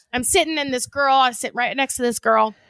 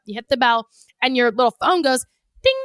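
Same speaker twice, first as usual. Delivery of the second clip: usual speaking voice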